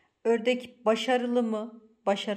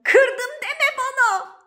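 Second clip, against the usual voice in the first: high, loud, drawn-out wail of dread; horrified outcry; 11 dB louder, slower than usual. Real, not acted. Owner fearing her clay jug broke, horrified outcry. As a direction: shout it slowly in horrified disbelief.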